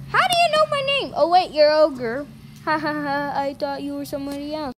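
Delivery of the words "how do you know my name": fast, high surprised question. Pretend.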